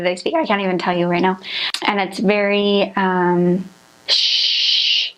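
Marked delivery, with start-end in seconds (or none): 4.1-5.1 a hissed shh showing Swiss German; harsh shh sound. Shown as a demonstration. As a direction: push out a long hissing shh. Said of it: "There's lots of shh".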